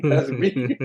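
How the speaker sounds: said through real laughter; laughing. Real, not acted. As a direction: let the laugh break around the words. Laughs while telling the story.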